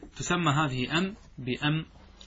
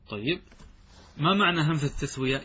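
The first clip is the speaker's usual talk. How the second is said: ordinary delivery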